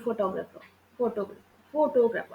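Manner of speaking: Photographer with the first syllable leaned on; low, soft photographer, no lift on the second syllable; wrong syllable stress. Shown as a demonstration.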